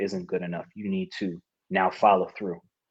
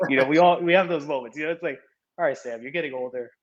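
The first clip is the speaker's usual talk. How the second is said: laughing through the words; amused; 5 semitones higher, faster than usual. Real, not acted. Athlete joking about getting older, amused and laughing. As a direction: chuckle at your own expense.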